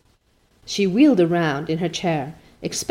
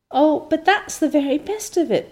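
eager protest, higher pitch; 8 semitones higher than usual. A narrator's reading. Anne protesting to Mrs Lynde, eager and higher. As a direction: push back brightly, a touch higher. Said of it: "protested Anne".

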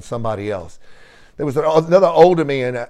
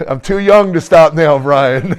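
laughing; 2 semitones higher, 6 dB louder, slower than usual. Real, not acted. Laughing comeback to friend's teasing.

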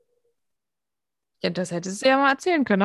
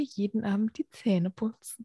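whispering; 2 semitones lower, 7 dB quieter than usual. Real, not acted. Joking aside about dog.

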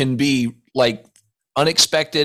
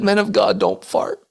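higher, mock-overwrought retelling of the dean; mock emotional dean quote; 7 semitones higher than usual. Retelling the dean's words to the host, mock tearful and higher. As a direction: quote it as if it still wounds you. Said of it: "he said".

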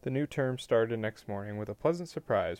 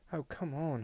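higher-pitched mild exasperation; exasperated; 6 semitones higher, 4 dB quieter than usual. A narrator's reading. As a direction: protest with understated impatience. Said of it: "said in exasperation".

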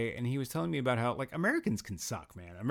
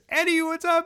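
high, loud hail across the store; called-out greeting; 7 dB louder than usual. Retold or imitated. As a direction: greet a neighbourhood regular, pitched way up. Acting out greeting in corner store.

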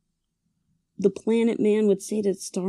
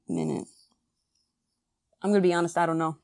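ordinary talk, nothing marked

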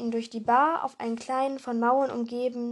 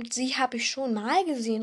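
plain reading voice

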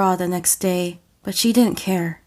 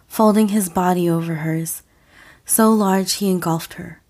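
usual speaking voice

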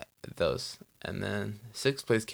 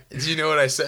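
higher, louder question through laughter; laughing; 6 semitones higher, 5 dB louder than usual. Real, not acted. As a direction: laugh while asking it. Laughing over own awkward French story.

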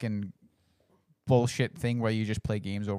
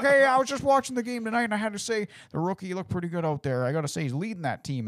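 much higher, hammed-up caller voice; mock radio caller; 10 semitones higher than usual. Imitating a radio caller praising the rookie, high and hammed-up. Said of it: "it's like".

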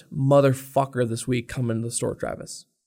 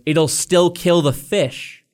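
exasperated insistence; 4 semitones higher, 5 dB louder, much slower than usual. Retold or imitated. Manager losing patience with customer.

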